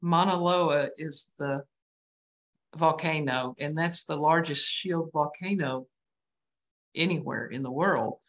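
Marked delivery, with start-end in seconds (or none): none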